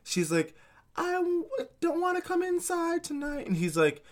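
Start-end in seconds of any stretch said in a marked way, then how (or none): none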